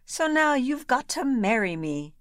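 very high, gleeful squeal; the ugly sister shrieking with glee; triumphant scream. A narrator's reading. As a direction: crow it as if you've won the prize. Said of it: "But she screamed".

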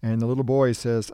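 ordinary delivery

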